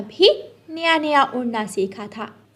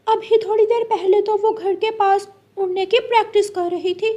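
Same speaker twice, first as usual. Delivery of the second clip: higher chirpy parrot voice; a helpful neighbour answering; 9 semitones higher than usual. Acted. Parrot telling mother bird where he saw Gudiya, higher chirpy voice.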